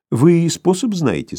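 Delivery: high, drawn-out curious query; interested question. A narrator's reading. Bormental asks Sharikov if he knows a method, interested. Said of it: "спросил заинтересованный Барменталь".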